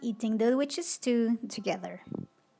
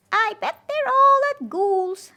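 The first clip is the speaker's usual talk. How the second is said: high, loud witch voice; hopeful witch; 12 semitones higher, 12 dB louder than usual. Acted. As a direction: sure she's found them. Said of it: "thought Little Witch".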